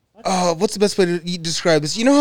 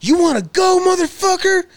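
high, loud tough-guy challenge; aggressive threat; 12 semitones higher, 4 dB louder than usual. Retold or imitated. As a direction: bark it like picking a fight. Retold threat from homeless guy.

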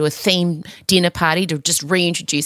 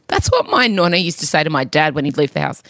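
ordinary delivery

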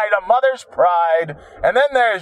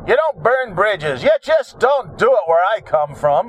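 ordinary talk, nothing marked

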